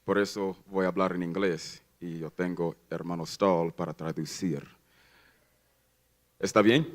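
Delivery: Spanish with an American accent; halting Spanish, American accent. Real, not acted. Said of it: "mi español es muy pobre". (español con acento americano)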